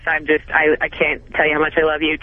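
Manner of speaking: usual speaking voice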